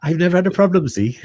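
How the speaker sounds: put-on teasing Welsh accent; playful Welsh accent. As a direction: show off an easy Welsh accent. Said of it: "consciously do a Welsh accent".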